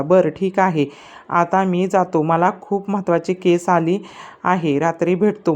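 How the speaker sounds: usual speaking voice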